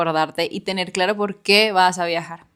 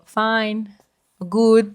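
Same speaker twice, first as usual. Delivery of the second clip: timid English answer; 4 semitones higher than usual. Retold or imitated. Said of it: "y yo les decía solo".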